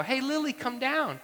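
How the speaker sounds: put-on high-pitched calling up to a child; mock yell. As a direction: imitate dad hollering up a slide.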